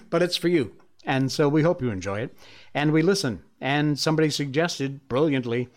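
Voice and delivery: ordinary delivery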